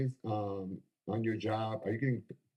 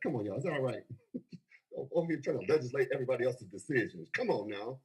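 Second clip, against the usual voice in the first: usual speaking voice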